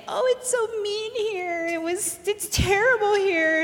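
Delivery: high-pitched mock complaint; mocking imitation. Mom mocking her complaining teenage daughter, high mocking voice. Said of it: "And she's mocking me".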